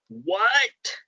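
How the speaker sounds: sudden high shocked What, not loud; Lily shrieks What as Diablo refuses her plan. Retold or imitated. Lily reacting to Diablo's refusal with a high shocked what. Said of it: "It's so loud you can hear it outside the house".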